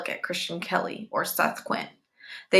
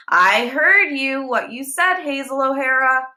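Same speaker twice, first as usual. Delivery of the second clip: angry scolding; 7 semitones higher, 4 dB louder than usual. A narrator's reading. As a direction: drag it out as a stern telling-off. Teacher scolding angrily.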